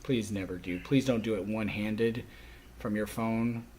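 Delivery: ordinary talk, nothing marked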